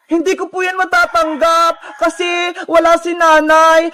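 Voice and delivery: ordinary talk, nothing marked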